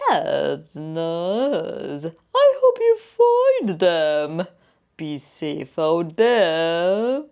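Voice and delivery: kind creature voice wishing well; caterpillar voice. Acted.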